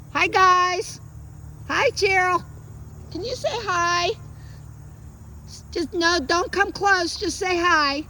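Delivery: high, lilting sing-out greeting; high dog-coaxing voice. Real, not acted. Trainer getting dog to say hi, high coaxing voice. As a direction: keep it high and encouraging.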